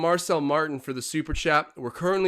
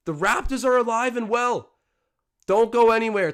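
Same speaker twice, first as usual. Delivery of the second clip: high, loud rising call of the comeback; excited play-by-play; 7 semitones higher, 6 dB louder than usual. Acted. Streamer calling the Raptors comeback for viewers, excited loud call. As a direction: sell the Raptors rally like a broadcaster.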